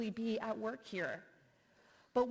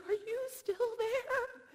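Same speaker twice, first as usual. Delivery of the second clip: much higher, slow, drawn-out plea down the phone; anxious call on hold; much slower than usual. Retold or imitated. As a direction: stretch it out, anxious and pleading. Anxious caller waiting on hold.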